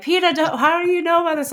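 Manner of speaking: squeaky mock outrage at peta; high mocking voice. Mocking the critics who tag peta, high squeaky voice. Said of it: "They're like".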